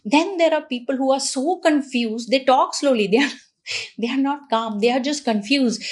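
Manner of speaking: ordinary talk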